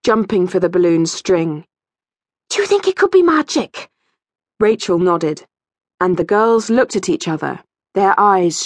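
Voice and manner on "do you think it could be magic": put-on high, eager girl's question; excited wondering. A narrator's reading. Kirsty asks her friend about the strange wind, excited wondering. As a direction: ask it high, full of wonder.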